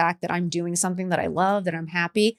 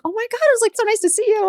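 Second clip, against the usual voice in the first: high, gushing fake-nice voice; much higher, syrupy polite greeting; fake-sweet greeting; 13 semitones higher, faster than usual. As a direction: gush over her like a sugary acquaintance.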